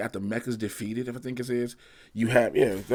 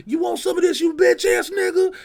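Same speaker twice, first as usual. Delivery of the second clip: high, loud squeaky taunt; cartoonish high-pitched monster challenge; Kong trash talk; 8 dB louder than usual. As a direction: voice Kong picking a fight, way up high. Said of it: "I'm picturing Kong go".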